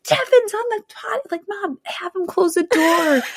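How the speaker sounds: high, slow tattle; tattling kid. Retold or imitated. Mom imitating her son tattling, high and slow. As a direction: tattle on the brother, slowly. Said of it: "He's like".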